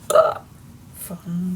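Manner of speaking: ordinary talk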